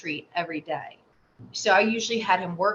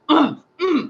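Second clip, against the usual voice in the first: two high, loud grunts; imitated angry grunts; 9 semitones higher, 7 dB louder than usual. Mother imitating her son's tantrum, loud high grunts. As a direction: mimic a child pounding the floor. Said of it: "I could hear him, like".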